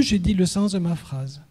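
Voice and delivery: normal talking voice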